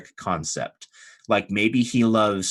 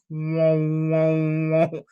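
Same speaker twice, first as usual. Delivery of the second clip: higher wavering "whoa" sound effect; imitated pulsing waves; 6 semitones higher, much slower than usual. Game master imitates the gushy feeling spreading, pulsing whoa sound. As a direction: voice the feeling swelling outward in waves. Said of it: "it's going like".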